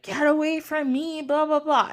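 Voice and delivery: high-pitched retold revulsion; mock grossed-out. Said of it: "Nebethet is grossed out".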